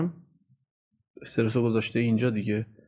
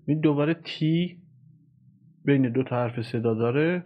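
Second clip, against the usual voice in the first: ordinary delivery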